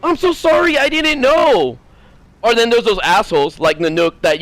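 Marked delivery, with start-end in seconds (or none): 0.0-1.8 much higher, gushing sorry; high mock-polite apology; apologetic sweet voice. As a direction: gush an apology like a nice person. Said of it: "Some of the people sometimes go".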